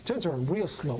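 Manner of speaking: plain reading voice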